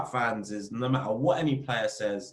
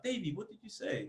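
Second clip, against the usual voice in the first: high, softer innocent question; imitating Julian; 10 semitones higher, 8 dB quieter than usual. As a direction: play the confused teammate, much higher and softer. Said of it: "he'll be like".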